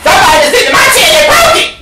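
very high, rapid cub squeal; Baby Bear. Acted. Little bear finding chair broken, shrieking tantrum. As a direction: squeal it fast like a cub having a fit. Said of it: "So then Baby Bear's like".

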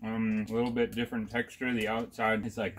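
speaking between bites of candy; chewing candy while talking. Real, not acted. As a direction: mouth partly full, chewing. Seen 0: Man puts candy in mouth at -2, talks chewing, holding more candy.

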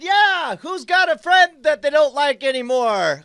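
high, cocky showman's yell; hyped-up boast. Acted. Fighter taunting the arena crowd, high and hyped up. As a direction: work the crowd up like a wrestler. Player hypes crowd as Friend Killer.

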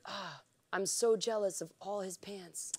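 playful, drawn-out jealousy; envious sigh. Retold or imitated. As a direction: sigh it out with mock envy. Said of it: "I was just like".

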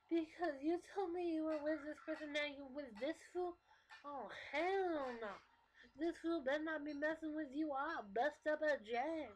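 high, sassy mock outrage; overdramatic friend. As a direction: play the outraged girlfriend, stretching the punch words. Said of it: "over-exaggerating how they act".

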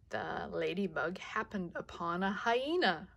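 plain reading voice

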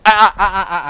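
loud sharp correction; sharp warning. Real, not acted. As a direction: warn the dog off sharply. Correcting dog that moves.

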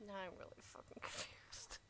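unvoiced whispered aside. Real, not acted. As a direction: breathe it under your breath.

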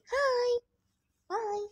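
very high tiny greeting; high toy voice; doll voice. Pretend. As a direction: make the doll wave hi and bye.

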